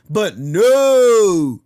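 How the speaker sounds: long, loud drawn-out NO; sarcastic, drawn-out refusal; exasperated. Real, not acted. Sarcastic end to political rant.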